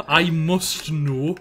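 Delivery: stretched-out slow delivery; slow, drawn out. Pretend. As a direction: drag every word out slowly. Slowed catchphrase.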